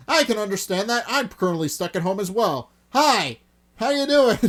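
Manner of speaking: high, booming interjection building to a shout; very loud, high-pitched greeting; loud calling out. Acted. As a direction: holler a big hello to everyone. Interjecting, loudly greeting the others.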